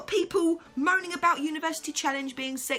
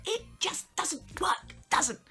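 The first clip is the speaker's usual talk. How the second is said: whisper; 6 semitones higher, slower than usual. Real, not acted.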